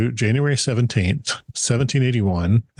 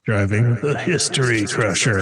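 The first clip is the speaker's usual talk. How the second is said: lightly hyped announcer read; a hint of a monster truck promo. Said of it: "car crusher monster truck commercials".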